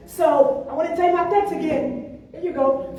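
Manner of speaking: ordinary talk, nothing marked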